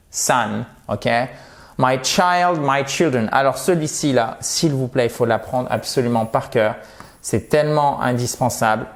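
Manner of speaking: usual speaking voice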